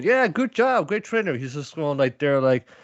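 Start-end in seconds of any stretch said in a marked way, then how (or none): 0.0-1.3 a much higher cheery mock voice; hammed-up praise; mock cheer. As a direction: chirp the praise like proud students. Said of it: "they're both going".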